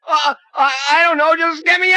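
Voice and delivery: high, frantic pleading; panicked. Acted. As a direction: beg to be freed. Trapped, pleading.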